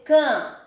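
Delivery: "cam" said as "kã", ending on a nasal vowel; nasal A, silent M. Shown as a demonstration. Teacher isolating the first syllable of campo, "cam" as nasal "kã". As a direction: leave the lips open on "cam", no M at the end. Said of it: "que é o som de an".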